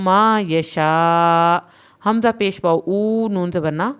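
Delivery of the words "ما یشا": very slow, stretching the شا; long madd. Shown as a demonstration. Teacher stretching the vowel for students, very slow drawn reading. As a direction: hold the madd three alifs long. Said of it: "تین الف کے برابر لمبا کیا ہے".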